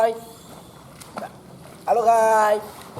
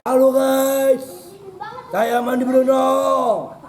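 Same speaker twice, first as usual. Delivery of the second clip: excited vlog greeting; 5 semitones higher, 5 dB louder than usual. Real, not acted. Slow loud vlog greeting.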